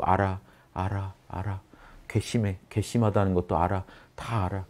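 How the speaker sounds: lying awake, grumbling inner voice; sleepless inner voice. Acted. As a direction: mutter it to yourself in bed. Sleepless mind replaying a fight.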